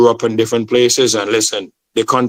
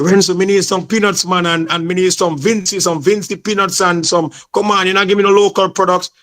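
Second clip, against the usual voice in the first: Jamaican patois; 6 semitones higher than usual. Retold or imitated. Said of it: "He said".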